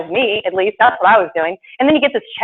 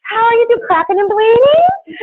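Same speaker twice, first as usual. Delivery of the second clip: high, loud, slow coo to a cow; baby-talk the cow about its udders; high mock-tender cow talk; 5 dB louder than usual. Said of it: "we're like".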